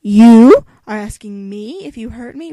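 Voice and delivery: loud, slow incredulous question. Acted. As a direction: throw the question back, stunned. Mocking the heroine's incredulity.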